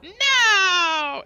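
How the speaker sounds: high, loud shrieked refusal; squeaky critter no. Acted. Critter refusing to behave, shrieking high. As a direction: shriek no like a defiant little critter.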